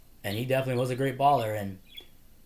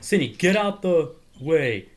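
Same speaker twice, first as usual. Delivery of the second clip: loud, slow, raised voice; acted impatient order; 6 semitones higher, 7 dB louder than usual. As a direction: imitate ordering someone aside, drawn out. Retold order to move aside.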